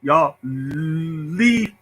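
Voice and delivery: stretched, blocked stammer; heavy stutter. Shown as a demonstration. Showing how he used to talk, stuck stutter. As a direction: hold the first sound long before the word comes. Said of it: "That's how I used to talk".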